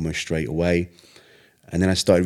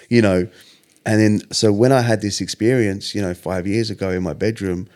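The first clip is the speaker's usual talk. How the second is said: normal talking voice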